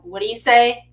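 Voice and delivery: loud mock-scolding demand; stern parent. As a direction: prompt a child for manners, loudly. Parent prompting child for manners.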